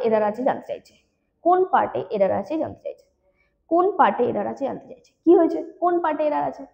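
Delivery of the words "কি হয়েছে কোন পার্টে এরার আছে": ordinary talk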